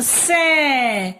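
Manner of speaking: सै held long, gliding down; drawn-out syllable. Shown as a demonstration. Teacher pulling the sound out of the word, drawn-out सै. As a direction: isolate and stretch सै. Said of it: "बोलते हैं ना".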